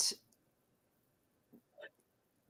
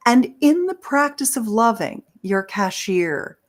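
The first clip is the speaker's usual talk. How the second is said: ordinary talk, nothing marked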